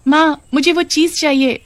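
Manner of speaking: put-on high childish pleading voice; a little girl whining for a treat; young daughter voice. Daughter begs her mother at the shop, high and childish.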